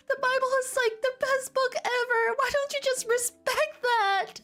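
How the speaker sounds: high, put-on girly whine; high whiny voice. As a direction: plead in a hammed-up high voice. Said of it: "Hermione sobbed femininely".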